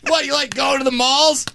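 high-pitched, exasperated comic rant; mock outrage. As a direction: throw the idea back, sky-high. Acted exchange, indignant refusal.

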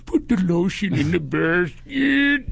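slow, creepy Buffalo Bill line; menacing, drawn-out creep voice; creepy slow whisper-like menace; creepy imitation. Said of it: "Me imitating him is freaking you out".

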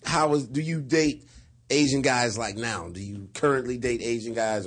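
ordinary delivery

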